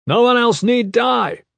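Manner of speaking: high, loud call to a crowd; shouted. A narrator's reading. Gavin calls to panicking nobles inside the wall, shouting. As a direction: shout it out with command. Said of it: "Gavin shouted".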